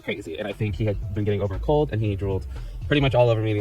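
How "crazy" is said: normal talking voice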